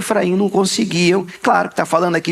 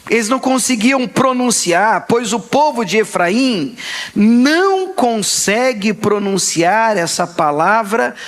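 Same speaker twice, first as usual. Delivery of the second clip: ordinary talk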